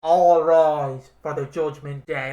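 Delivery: hammed-up arena announcement; ring announcer. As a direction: boom it out like an entrance call. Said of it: "it's like".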